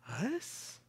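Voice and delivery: high squeaky incredulous Us; disbelieving crowd. Pretend. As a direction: crowd doubting they could change the world, incredulous.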